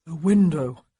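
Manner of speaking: a hint of a weak sick man; strained, pleading sick man; feverish plea. A narrator's reading. Sick man to Honoria, a touch strained.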